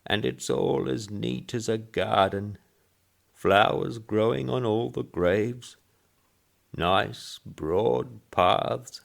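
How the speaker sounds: put-on high quavery old voice, slow at the end; quavering old man. Old man pleased with the graves, quavering. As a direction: savour the broad paths, old man.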